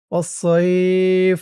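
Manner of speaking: সইফ ends on a stopped ফ, long ই drawn out; pausal pronunciation. Shown as a demonstration. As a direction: stretch the ই in সইফ, stop on ফ. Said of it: "এই ওয়াস সই ফি শব্দের উচ্চারণটা". (অক্ফ করে সইফ, টেনে)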